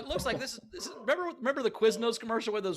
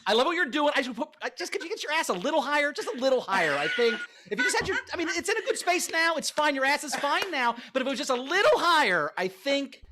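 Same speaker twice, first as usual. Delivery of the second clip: higher, louder fussy director with a laugh; impression of Ben; 5 semitones higher, 5 dB louder than usual. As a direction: coax like a director asking for more. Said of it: "Ben mastered my impression".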